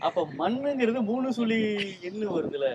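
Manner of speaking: ordinary talk, nothing marked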